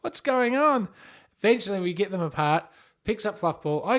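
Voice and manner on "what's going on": high, slow, alarmed wail; a hammed-up panicked cry. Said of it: "I was going".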